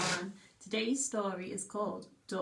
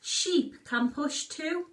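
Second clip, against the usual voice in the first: frog croaks; 6 semitones higher, 4 dB louder, slower than usual. Acted. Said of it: "croaks Frog".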